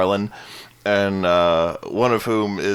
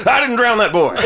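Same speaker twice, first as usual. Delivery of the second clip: higher, louder jolt awake denial; blurting priest, half-laughing; startled priest voice; 12 semitones higher, 7 dB louder than usual. Retold or imitated. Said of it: "he wakes up and he's like".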